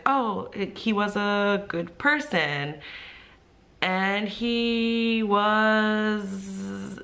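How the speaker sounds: slow, drawn-out hesitation; hesitant student. Retold or imitated. Student unsure how to answer her teacher, hesitant. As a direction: stall on the last words, stretching them out.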